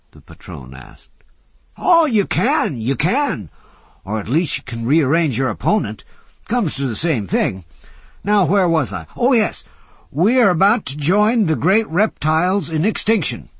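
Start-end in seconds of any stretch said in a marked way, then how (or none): 1.8-13.5 put-on high, loud grand old man; a lightly theatrical old philosopher lecturing; old orator. A narrator's reading. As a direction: hold forth with gleeful doom. Old Jay's voice.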